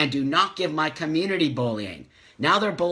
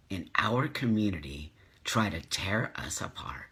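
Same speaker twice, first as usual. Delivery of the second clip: whispered and softer in disgust; frustrated whisper; 6 semitones lower, 6 dB quieter than usual. Real, not acted. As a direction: hush it out in frustration.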